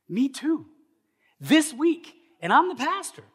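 very high, loud outburst; comic exclamation. Acted. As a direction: squeal it in exaggerated agreement. Comic exclaimed agreement with objector.

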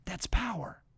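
breathy whispered repeat. Acted. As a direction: drop to a hushed whisper for effect.

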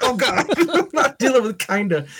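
retold reaction said high through laughter; amused, oh God, laughing at kinda; laughing disbelief. Husband laughing at a review saying it fits kind of.

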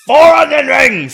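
high, loud yell to the ceiling; scream to heavens. Retold or imitated. Jacques screaming his order to the heavens, loud. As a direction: bellow it upward. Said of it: "screaming to the heavens".

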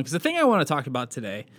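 normal talking voice

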